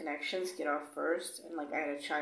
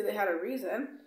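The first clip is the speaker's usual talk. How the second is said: ordinary talk, nothing marked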